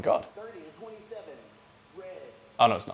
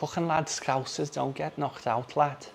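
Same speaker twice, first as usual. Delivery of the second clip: put-on Scouse accent; 5 semitones higher, slower than usual. Mimicking a Liverpool fighter.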